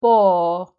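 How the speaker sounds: "po" low with a long held o; weaker long syllable. Shown as a demonstration. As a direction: drop the pitch on a drawn-out "po". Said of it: "is weaker".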